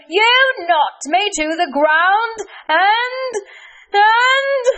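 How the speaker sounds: high, loud, slow shock turning; Cranky Kareem building. Acted. Kareem realising Hassan saved him, high building outcry.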